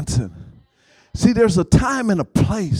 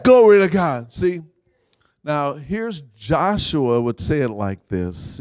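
ordinary talk

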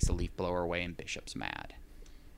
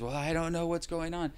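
lightly voiced dazed man, higher; dazed neighbor; 8 semitones higher than usual. The wounded neighbor stumbles upstairs, lightly voiced and dazed. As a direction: play the stunned neighbor stumbling in.

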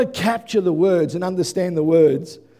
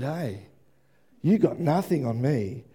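quiet confident dismissal; 9 semitones lower, 7 dB quieter than usual. Acted. Jesus quietly dismissing death.

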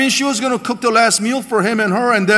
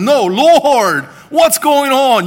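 louder and higher, a desperate protest to God; desperate cry; 6 semitones higher, 6 dB louder, much slower than usual. Retold or imitated. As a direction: cry it out, pleading and alarmed. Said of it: "it's like".

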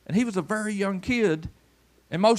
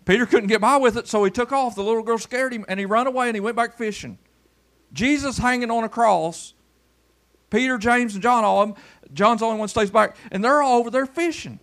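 preaching; 6 semitones higher than usual. Acted. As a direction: drive the story home from the pulpit. Preaching rises to full force.

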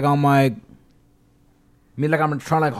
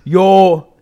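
loud, pushy Yo yo in Toronto slang; mock loud greeting; 8 dB louder than usual. Mocking how the visitor greets him, loud Yo yo. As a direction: bark the greeting at a stranger. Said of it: "do I come in L A and go".

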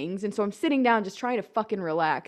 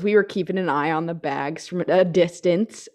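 normal talking voice

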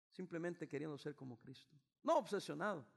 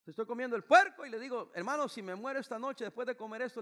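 mock toast; 5 semitones higher, 9 dB louder than usual. Joking toast at a meal.

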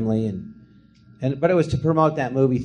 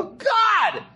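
very high shrieking yelp; hammed-up horrified cry; shocked scream. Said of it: "And I go".

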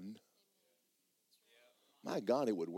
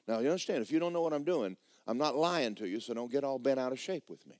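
ordinary delivery

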